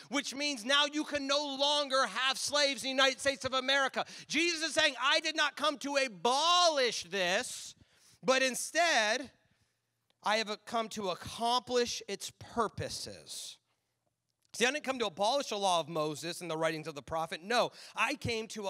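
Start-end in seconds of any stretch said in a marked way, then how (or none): 5.0-13.6 slow, emphatic scripture reading. Acted. Pastor reading Jesus' words to the church, slow and emphatic. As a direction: read Jesus' words with weight.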